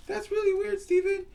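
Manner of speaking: very high squeaky doll voice; high-pitched puppet Susie Q; squeaky girl puppet. Acted. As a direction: play a tiny girly doll. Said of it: "we'll call you Susie Q".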